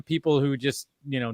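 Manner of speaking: normal talking voice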